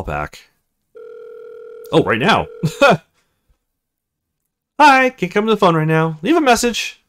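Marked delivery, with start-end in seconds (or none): 2.5-3.0 laugh. Real, not acted. Reacts to own voicemail. 4.8-7.0 higher, louder quick greeting; breezy recorded hello; own voicemail greeting. Acted. Jack's own voicemail greeting, higher and brisk.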